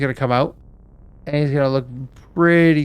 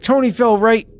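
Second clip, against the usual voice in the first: high, loud crewman's alarm; urgent report; 9 semitones higher, 6 dB louder than usual. Acted. Crewman running up to the captain, urgent. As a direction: blurt it out as you run up. Crewman runs up after man overboard.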